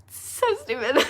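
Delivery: high embarrassed laugh; giggling at her own tears. Real, not acted. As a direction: laugh it off with a squeal. Laughing at herself while teary.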